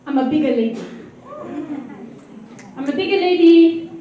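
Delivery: usual speaking voice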